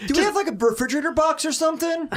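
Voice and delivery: put-on high excited kid shout to mom; kid calling mom. Ten-year-old self asking mom for a box, high shout. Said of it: "I'm like".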